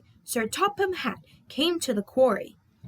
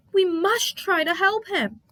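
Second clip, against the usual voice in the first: urgent; 8 semitones higher, 4 dB louder than usual. Acted. Percy urgent.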